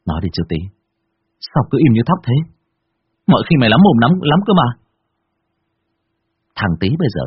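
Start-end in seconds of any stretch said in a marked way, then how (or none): 0.1-4.7 urgent pressing. Acted. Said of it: "chộp lấy vai bạn mà lắc mạnh".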